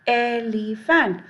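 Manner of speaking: "elephant" slow and drawn out, showing the first vowel; sounded-out pronunciation. Shown as a demonstration. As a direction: stretch out "elephant" syllable by syllable. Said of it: "we'll pronounce". ("elephant" धीरे-धीरे खींचकर)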